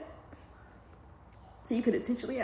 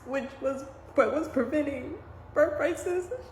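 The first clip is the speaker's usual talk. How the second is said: fighting back tears; 6 semitones higher than usual. Real, not acted. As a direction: voice climbing high as tears come. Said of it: "I'm trying not to cry".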